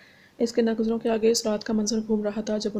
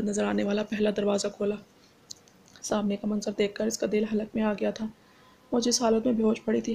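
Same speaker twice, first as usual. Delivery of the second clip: plain reading voice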